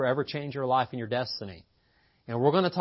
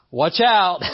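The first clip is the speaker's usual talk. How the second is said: loud, higher playful warning; 6 semitones higher, 10 dB louder than usual. Real, not acted. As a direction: call out a joking warning. Joking warning before laughing.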